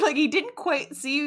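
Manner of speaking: normal talking voice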